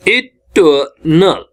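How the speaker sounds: "Eternal" slowly as i, tuh, nal; syllable by syllable. Shown as a demonstration. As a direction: pause after each syllable of "eternal", r silent. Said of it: "तीन syllable का है". ("Eternal" तीन हिस्सों में, धीरे)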